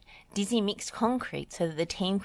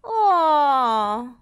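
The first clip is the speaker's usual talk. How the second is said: long, loud, high wail; falling cry of dismay; cartoon wail; 9 semitones higher, 10 dB louder than usual. A narrator's reading. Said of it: "wailed Dizzy".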